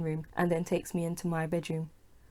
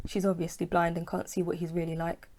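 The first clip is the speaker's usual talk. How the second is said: ordinary delivery, nothing marked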